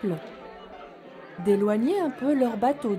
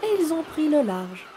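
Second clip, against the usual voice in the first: soft storytelling voice; 4 dB quieter than usual. A narrator's reading. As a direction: read gently to little children. Story narration.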